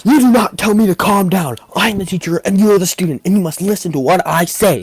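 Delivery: high, loud mock-teacher outburst; snapping. Teacher snapping at a student who said calm down, retold anger. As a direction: snap and pull rank, quickening through the middle. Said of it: "then she just snaps".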